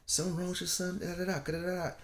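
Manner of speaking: nosy adults. Retold or imitated. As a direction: poke at the father about his son. People questioning the quiet son.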